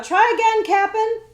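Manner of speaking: loud and high call. Acted. Boy calling up to the stuck coachman, loud and high. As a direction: yell it up to the coachman.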